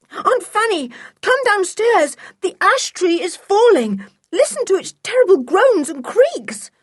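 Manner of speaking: high, loud, urgent shouting; frantic boy pleading at high pitch; impatient yell. A narrator's reading. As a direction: yell as if beside yourself with impatience. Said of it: "yelled Julian".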